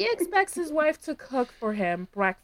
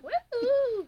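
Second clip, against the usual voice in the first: high whooping cheer; excited cheer; 14 semitones higher than usual. Retold or imitated. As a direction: whoop it out like a fan. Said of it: "she's all like".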